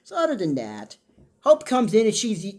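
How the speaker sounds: ordinary delivery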